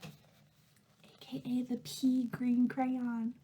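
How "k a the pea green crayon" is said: ordinary delivery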